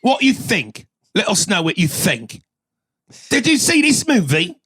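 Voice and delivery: high-pitched, exaggerated British accent; mock English accent. Guest teases Brandon from the UK, mock English accent. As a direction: quiz him in a hammed-up English accent.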